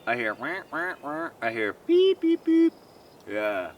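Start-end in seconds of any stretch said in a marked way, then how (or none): 0.3-0.9 bird call imitation. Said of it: "Hear all the birds?". 1.8-2.4 high beep beep chirps; bright high beeping bird calls; bird chirp imitation. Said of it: "Hear all the birds?".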